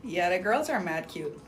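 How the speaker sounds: normal talking voice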